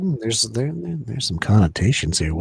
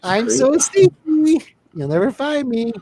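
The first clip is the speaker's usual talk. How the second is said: mock sneaky voice, much higher and slow; sneaky woman; 12 semitones higher, 5 dB louder, slower than usual. Voicing the sneaking woman on screen, high mock sneaky voice. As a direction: tiptoe through it in a high cartoon voice.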